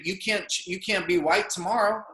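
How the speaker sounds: normal talking voice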